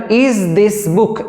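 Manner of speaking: very slow, each word drawn out; slow dictation. Shown as a demonstration. Teacher dictating the question to students, very slow. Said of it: "লেখা যাক".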